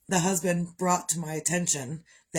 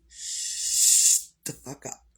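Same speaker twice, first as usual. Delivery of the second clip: slow, forceful hiss; hissed demand to husband for silence; hissed order; 3 semitones lower, 3 dB louder, much slower than usual. Retold or imitated. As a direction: spit it out slowly through the teeth. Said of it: "I really looked at him and I said".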